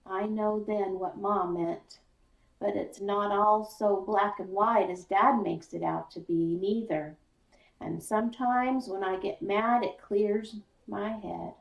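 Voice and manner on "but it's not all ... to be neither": ordinary delivery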